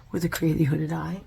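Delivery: normal talking voice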